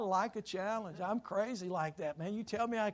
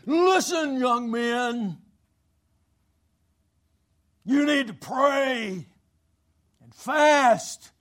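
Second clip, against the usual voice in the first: put-on loud, slow preacher's call to young men; a hint of the preacher's booming challenge; imitating Brother Laurent; 4 semitones higher, 9 dB louder, much slower than usual. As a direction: boom it out like a stern old preacher challenging students. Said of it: "Now, I can't even say it like he did".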